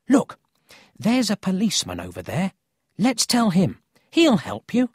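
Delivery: high, loud, urgent suggestion; eager boy. A narrator's reading. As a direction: urge your friend into action. Paul urges Emil to tell police.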